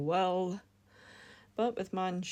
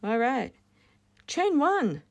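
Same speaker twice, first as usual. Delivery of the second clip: high, louder put-on voice; odd silly voice; 9 semitones higher, 8 dB louder than usual. Real, not acted. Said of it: "I don't know why I'm talking like that".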